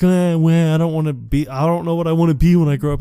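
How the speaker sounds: high, fast whiny imitation; mocking whine. Mocking Dean for not knowing his future, high whiny imitation. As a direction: mimic a dithering man in a high voice. Mocking Dean's indecision about life.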